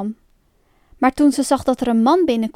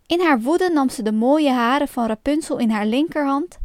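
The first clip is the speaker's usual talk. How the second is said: usual speaking voice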